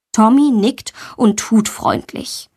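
plain reading voice

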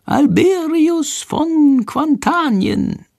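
very high, very slow prompting; tiny bird whispering the answer from hiding. A narrator's reading. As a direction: spell it out slowly for the boy. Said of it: "Shandors leise Stimme ... vorsagen".

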